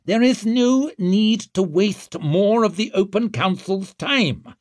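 high, forceful official shout. A narrator's reading. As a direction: shout down the objectors. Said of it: "shouted Glockter".

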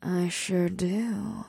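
breathy purring seductress. A narrator's reading. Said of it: "The dark-haired succubus purred".

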